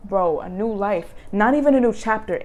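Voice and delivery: usual speaking voice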